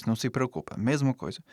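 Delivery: ordinary talk, nothing marked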